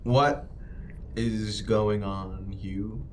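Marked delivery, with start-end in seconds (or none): none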